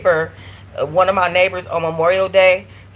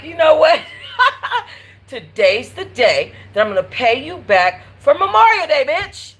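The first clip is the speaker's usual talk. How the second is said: payback taunt; 8 semitones higher than usual. Retold or imitated. Narrator taunting the neighbor about Memorial Day, laughing mock threat. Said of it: "I just was like".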